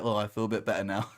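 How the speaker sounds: soft, fast, sheepish admission; a quiet, grudging oh I feel better; sheepish softening. Retold or imitated. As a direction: give in gently, almost mumbled. Sheepish retold reply after massage.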